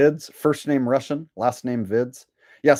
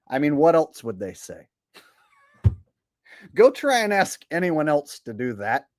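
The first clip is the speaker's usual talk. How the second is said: ordinary talk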